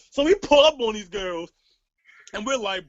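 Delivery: usual speaking voice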